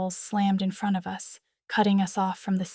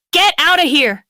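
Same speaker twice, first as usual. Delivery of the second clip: high, loud barked order; 8 semitones higher, 10 dB louder than usual. A narrator's reading. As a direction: bark the order at them. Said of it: "he barked".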